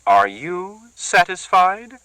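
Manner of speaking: high, slow, each word a jab; jabbing taunt. Acted. Bertha taunts Celia, each word jabbed high and slow. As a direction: jab every word at her. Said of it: "each word aimed like a jab".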